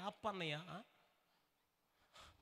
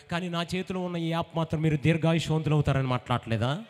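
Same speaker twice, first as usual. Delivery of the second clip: loud, lower, driving sermon voice; fervent preaching; 5 semitones lower, 16 dB louder than usual. Real, not acted. Pastor holding up the Bible to the sick, loud preaching. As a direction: proclaim it with full force.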